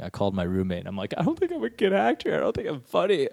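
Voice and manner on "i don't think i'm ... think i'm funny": high, whiny mock despair; hammed-up tearful doubt; dramatic despair. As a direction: wail it like a crushed performer. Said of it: "I went through a whole dramatic thing".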